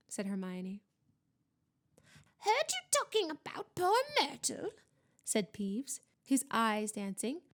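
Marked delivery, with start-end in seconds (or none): 2.4-4.7 high-pitched poltergeist voice, needling; teasing poltergeist. A narrator's reading. Poltergeist pesters the students about a ghost, teasing. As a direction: tease them as a high-voiced mischievous spirit.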